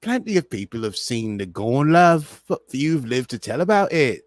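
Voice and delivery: hammed-up London accent with love; mock Cockney accent. Host parodying La'an's line, hammed-up Cockney. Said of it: "my version of her accent".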